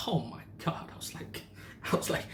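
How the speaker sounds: breathy whispered shock; whispered oh my God. Real, not acted. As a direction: drop to a hushed whisper. Shocked aside in the story.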